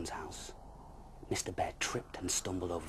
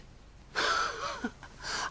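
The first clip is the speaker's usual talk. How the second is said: delighted laugh. Acted. Bear finding baby.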